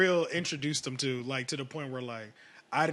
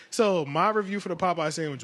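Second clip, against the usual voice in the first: usual speaking voice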